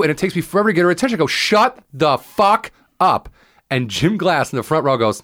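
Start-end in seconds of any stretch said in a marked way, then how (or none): 1.3-3.3 retold yell. Comic finally snaps at heckler.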